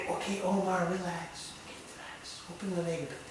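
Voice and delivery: hushed, near-whispered coaxing; calm the bird down softly, barely voiced; soothing an owl. Handler gently coaxes owl Omar to relax, soft hushed soothing.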